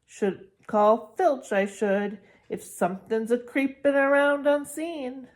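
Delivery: sly poltergeist. Acted. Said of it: "squinting at them".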